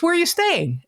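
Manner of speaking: very high, lilting question; Keith Morrison. Retold or imitated. TV idol asks fan about lodging, high lilting voice. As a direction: ask it way up high, with interest. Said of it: "And he goes".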